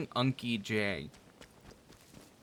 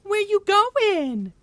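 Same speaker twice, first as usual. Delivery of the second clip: put-on much higher, slow, kid-like voice; little nephew imitation; 11 dB louder, much slower than usual. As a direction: imitate a toddler dragging the words out. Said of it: "my nephew is like".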